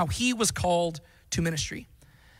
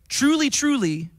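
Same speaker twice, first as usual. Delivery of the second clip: "Truly, truly" called out higher and louder, drawn out; emphatic repetition; 7 semitones higher, 5 dB louder, slower than usual. Retold or imitated. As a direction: catch the crowd's attention with the double word. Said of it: "If he's trying to get people's attention".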